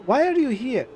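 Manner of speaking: upset. Acted. Angry confrontation at the door.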